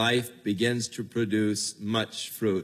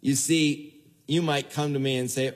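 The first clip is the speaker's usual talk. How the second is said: ordinary talk, nothing marked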